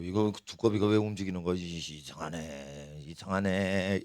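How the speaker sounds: low, hushed puzzlement; puzzled muttering. Acted. Police noticing the toads move, puzzled low muttering. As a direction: mutter it softly to yourself, bemused.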